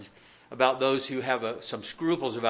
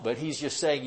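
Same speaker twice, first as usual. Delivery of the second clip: ordinary delivery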